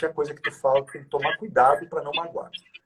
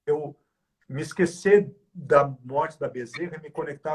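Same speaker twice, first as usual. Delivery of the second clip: ordinary talk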